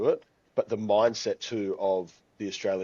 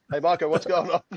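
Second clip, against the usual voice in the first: a hint of a hearty American, pitched higher and louder; mock American greeting; 8 semitones higher, 5 dB louder than usual. Guest imitating Americans greeting his friend Michael, mild mock American. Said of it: "everyone thinks Michael's name is Marco".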